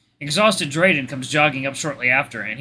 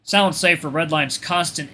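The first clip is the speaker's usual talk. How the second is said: usual speaking voice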